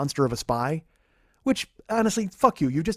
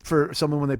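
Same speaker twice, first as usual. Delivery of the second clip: normal talking voice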